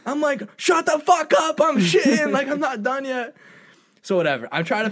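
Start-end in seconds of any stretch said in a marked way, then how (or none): 0.6-3.4 high, loud yelling from a stall; loud high annoyed bathroom shout; mock yell. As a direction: shout them off, still busy. Retold yell from the toilet.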